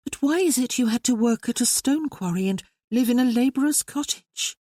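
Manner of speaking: a sister asking her brother, pointedly; young sister Maria. Acted.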